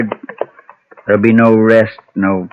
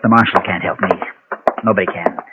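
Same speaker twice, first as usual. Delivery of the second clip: ordinary talk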